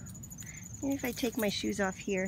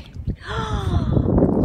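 loud shocked gasp; sharp gasp; 11 semitones higher, 13 dB louder than usual. Real, not acted. Woman in the water gasps in shock. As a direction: gasp at something touching you.

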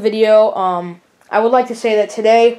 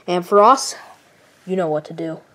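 ordinary talk

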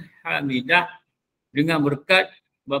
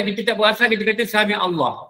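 usual speaking voice

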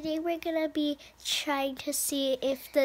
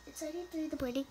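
rushed; 7 dB quieter, much faster than usual. Real, not acted. Hurried night chatter.

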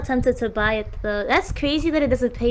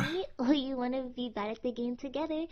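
soft voice; 7 dB quieter than usual. Real, not acted. Girl's soft voice message.

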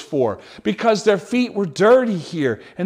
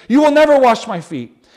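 loud, higher protest; protesting Peter; 4 semitones higher, 8 dB louder than usual. Acted. Peter refusing Jesus at the foot washing, loud protest. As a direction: push back hard, refusing. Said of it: "Peter protested".

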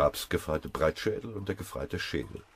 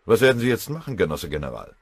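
loud, higher pressing question; urgent question; 5 semitones higher, 8 dB louder, faster than usual. Acted. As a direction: demand an answer from the general. Drängende Frage an den General.

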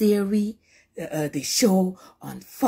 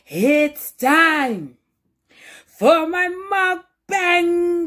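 drawn-out hype; 10 semitones higher, 4 dB louder, much slower than usual. Real, not acted. Stretched high announcement.

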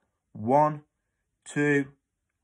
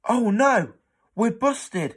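high, loud panicked cry; child caught out, squealing in alarm; panicked boy; 12 semitones higher, 8 dB louder than usual. Acted. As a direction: yelp it out in sudden panic.

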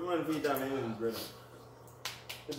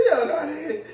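usual speaking voice

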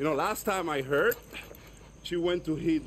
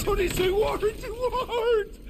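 much higher and louder, whooping; hyped yelling; 5 dB louder, much slower than usual. Acted. Guerrilla whoops at Dani's tough answer, hyped yelling. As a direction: shout it like a hype man.

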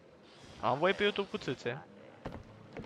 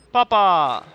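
loud, raised-pitch holler; calling out; 4 semitones higher, 12 dB louder than usual. Real, not acted. Player calling out in the game, loud holler. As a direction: call it out long.

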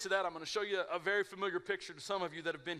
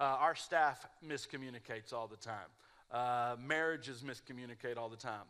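ordinary talk

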